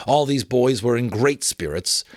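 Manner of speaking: plain reading voice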